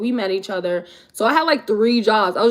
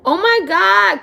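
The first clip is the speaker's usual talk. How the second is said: delighted surprise; 9 semitones higher, 4 dB louder, slower than usual. Real, not acted. Spots a friend in chat.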